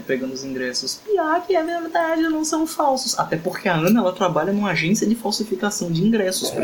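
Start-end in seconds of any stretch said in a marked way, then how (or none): 1.0-3.2 high squeaky character voice. Acted. Character voice over tickets.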